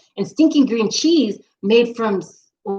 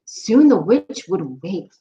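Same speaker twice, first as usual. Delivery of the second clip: usual speaking voice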